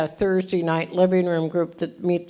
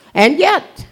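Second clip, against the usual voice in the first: much higher, louder cheer; excited burst; 9 semitones higher, 9 dB louder than usual. Real, not acted. Boasting to the crowd about her pom-poms, with excitement.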